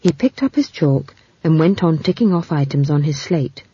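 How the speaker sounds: ordinary delivery, nothing marked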